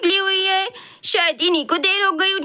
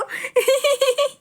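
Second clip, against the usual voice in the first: high cackling laugh; grandma laughing; 7 semitones higher than usual. Acted. Grandmother laughs at grandson's hurry, high laugh. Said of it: "हंसिए नहीं please".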